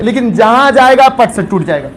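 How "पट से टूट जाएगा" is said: usual speaking voice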